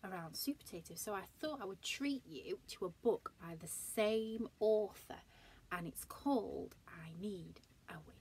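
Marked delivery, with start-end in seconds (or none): 5.7-8.1 hushed title reveal; whispered. Real, not acted. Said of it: "teacher teasing the title".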